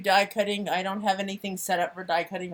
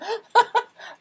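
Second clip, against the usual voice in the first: high laughing burst; giggling laugh; 13 semitones higher, 6 dB louder than usual. Real, not acted. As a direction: keep laughing helplessly. Laughing at Alexa.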